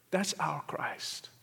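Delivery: soft, breathy slow whisper of devotion. Real, not acted. Preacher hushing the crowd on Christ, whispered. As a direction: drop to a hushed whisper to land the point. Preacher hushes on key line.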